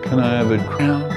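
ordinary talk

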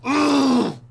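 loud, high groan of frustration; frustrated cry. Real, not acted. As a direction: let out an exasperated wail. Groans after screaming.

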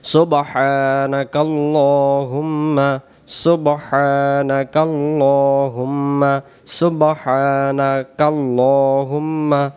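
drawn-out slow Arabic recitation; slow careful Quranic-style chant. Shown as a demonstration. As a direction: stretch every syllable slowly. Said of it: "নামাজের সানা।".

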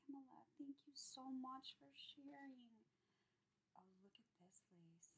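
grateful hushed whisper. Real, not acted. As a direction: breathe the words out in a hush. Thanking sender, admiring lace.

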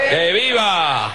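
high, slow rally shout. Real, not acted. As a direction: answer the crowd. Crowd reply.

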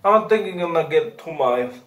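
talking around candy in mouth; chewing while talking. Real, not acted. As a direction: mouth puckered from sour candy. Seen 1: Holds candy bag, grimacing with moving mouth, hand pressing cheek throughout.

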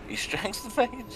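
high, slow, disbelieving; can't believe what he's seeing; incredulous. Real, not acted. Disbelief at a misplay.

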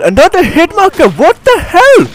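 high, loud, incredulous exclamation; exasperated outburst. Real, not acted. Gamer reacting to another hit marker, exasperated. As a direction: cry out in disbelief at the game.

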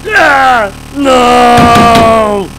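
loud, high wails of no; a real meltdown as the screen dies; screaming in despair. Real, not acted. Kid watching his PC blue-screen, screaming loud and high.

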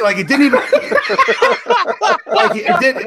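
loud, high, breaking into real laughter; helpless laughing speech. Real, not acted. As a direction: try to go on while cracking up. Cracking up at own story.

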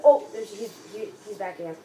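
ordinary delivery

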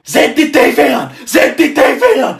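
loud, high repeated yell; angry shout. Acted. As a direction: hammer the demand, yelling. Yelling repeatedly for TV.

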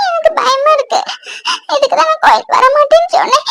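high, frightened sobbing; tearful, scared voice with sobbing breaths; crying. Acted. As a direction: speak through tears, pitch climbing high. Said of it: "அழாத".

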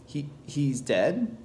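hushed, hesitant question in a story reading; shocked disbelief. A narrator's reading. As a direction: falter softly on hearing of a death. Child learns a boy has drowned.

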